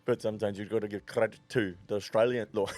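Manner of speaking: low South African coach impersonation, close to laughing; South African accent. Retold or imitated. Coach giving credit to the winners, in a South African accent. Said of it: "Better than mine".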